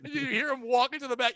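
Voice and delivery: ordinary talk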